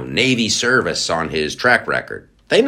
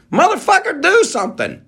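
much higher frustrated shout; exasperated yell; 3 dB louder than usual. Real, not acted. Host yelling at a border politician, exasperated shout. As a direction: yell at him to act.

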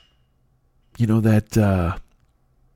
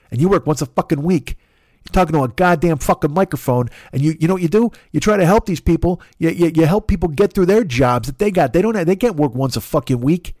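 angry South Side Chicago rant; 6 semitones higher than usual. Retold or imitated. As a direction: chew himself out like a tough Chicago guy. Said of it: "he talks to me and he's like".

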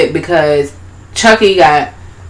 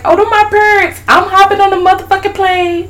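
much higher, eager puppet voice; high excited doll. Retold or imitated. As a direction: announce it like a thrilled kid. Voicing Shitface.

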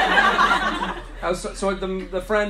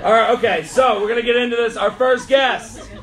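loud, raised hype voice building to a shout; stage announcer introduction; 5 semitones higher, 6 dB louder than usual. Real, not acted. Host bringing up the first comic, loud announcer hype. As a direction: build up the crowd to welcome a guest.

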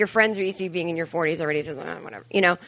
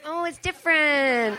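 slow, laughing, patronizing; slow amused condescending aside; knowing condescension; 7 semitones higher than usual. Retold or imitated. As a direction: pat them on the head with it. Condescending reply to teen.

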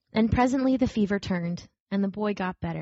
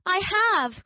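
indignant cry; 12 semitones higher than usual. A narrator's reading. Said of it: "cried the little rabbit".

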